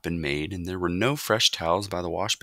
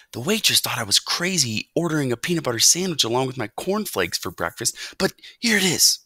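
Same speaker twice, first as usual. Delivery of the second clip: lightly voiced boy, higher; hint of a pleased boy delivering the goods; boy character, chatty; 7 semitones higher, 3 dB louder than usual. A narrator's reading. Boy brings mouse the sandwich, chatty.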